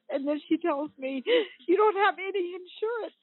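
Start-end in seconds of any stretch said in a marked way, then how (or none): 1.7-3.1 tearful, pitched up; crying. Real, not acted. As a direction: voice cracks high with real tears. Breaks down recounting the firing.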